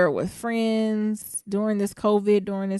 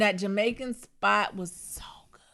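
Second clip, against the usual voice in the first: soft unvoiced whisper; 3 semitones higher, 5 dB quieter than usual. Real, not acted. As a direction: breathe it out in a whisper. Fond memory of food.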